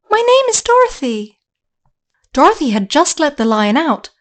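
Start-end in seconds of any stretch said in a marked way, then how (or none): 0.1-1.4 put-on girlish voice. Dorothy introduces herself.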